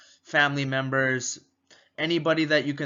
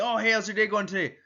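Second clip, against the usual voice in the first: higher, fast upbeat hello; cheerful greeting; 7 semitones higher, faster than usual. Retold or imitated. Customer opens the door, upbeat and fast. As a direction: greet with bubbly energy.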